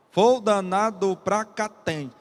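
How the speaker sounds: slow, measured syllables stopping on the stressed last one; syllable-by-syllable scansion. Shown as a demonstration. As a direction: count out each beat, cutting the final unstressed syllable. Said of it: "só conta até a última sílaba tônica".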